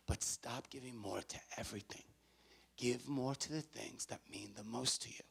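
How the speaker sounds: quiet, measured plea with pauses; hushed earnest appeal. Real, not acted. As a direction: bring it right down and mean it. Drops from shouting to earnest plea.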